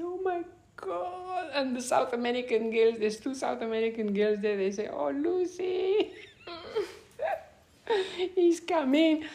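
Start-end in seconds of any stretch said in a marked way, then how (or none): 0.0-1.5 high, drawn-out "oh my God"; retold swooning dismay; dismayed exclamation. Retold reaction to man arriving. 4.9-9.2 put-on high, drawn-out girlish teasing with a laugh; mock swoony singsong. Girls teasing her, acted out.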